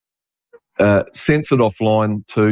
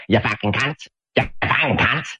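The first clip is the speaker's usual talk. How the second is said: imitating feral Aussie; 2 semitones higher, 5 dB quieter than usual. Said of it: "the ferals".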